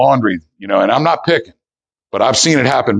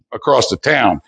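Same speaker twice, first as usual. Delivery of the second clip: ordinary delivery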